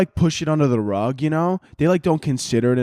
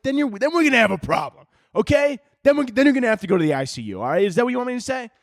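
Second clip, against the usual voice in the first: high, fast, fed-up doctor snapping at a patient; exasperated doctor; 10 semitones higher, faster than usual. Pretend. Doctor fed up with questions snaps, high and fast. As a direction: snap back like a doctor out of patience. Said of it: "I wish one doctor would snap on me".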